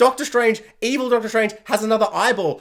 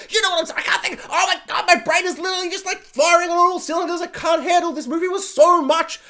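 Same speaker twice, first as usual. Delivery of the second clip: much higher, fast, buzzing; wired, can't-think squeal; frantic overexcited fan; 9 semitones higher than usual. Acted. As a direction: babble like your brain's on fire. Acting dazed post-movie fan.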